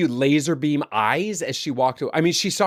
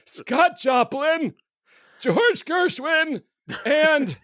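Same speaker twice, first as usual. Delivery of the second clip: much higher, very slow mocking drawl of names; teasing the cohost, much higher and drawn out; mock posh voice; 13 semitones higher, much slower than usual. Mock guessing his favourite composers.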